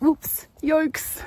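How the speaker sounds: acted-out awkward oops; sheepish oops. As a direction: imitate an awkward, apologetic little reaction. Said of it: "I kinda gave them a look like".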